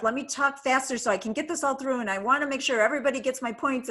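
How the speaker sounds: fast, hurried mock inner voice; rushed speaker. Speaker mimicking a presenter running out of time, fast hurried mock. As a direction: rattle it off like you're out of time. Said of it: "you speed up".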